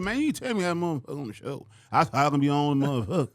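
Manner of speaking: loud, brash mock complaint; imitating Bernie. Bernie ribbing the promoter, loud and brash. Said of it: "he talking to the promoter".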